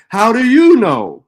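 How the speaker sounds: loud mocking echo of a doubter; mocking quote. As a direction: throw their question back loudly, mocking. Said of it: "turns around and says".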